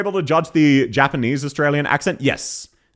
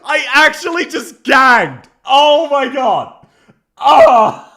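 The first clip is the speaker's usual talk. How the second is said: high, loud, drawn-out wail of disgust; appalled outcry; 11 semitones higher, 9 dB louder, much slower than usual. Real, not acted. Streamer recoiling from a terrible accent, loud appalled outcry. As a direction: wail it slowly in real dismay. Appalled after hearing the clip.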